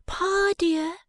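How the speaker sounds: put-on a bright young wife teasing her Pa; young Bella. Bella calls from the wharf.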